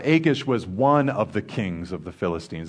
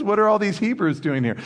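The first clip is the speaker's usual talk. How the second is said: retold question; 9 semitones higher than usual. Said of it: "commanders are like".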